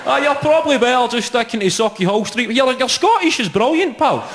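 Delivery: a driver humouring the tourist; cheery taxi driver. Acted.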